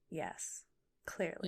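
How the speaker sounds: soft unvoiced whisper. Real, not acted. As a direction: breathe it, no voice. Teasing reply, whispered.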